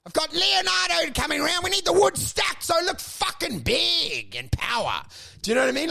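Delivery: high, hammed-up diva rant; mock rant. Actor ordering the cleaner about, hammed-up rant. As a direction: keep ranting like a pampered star.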